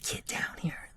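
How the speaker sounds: plain reading voice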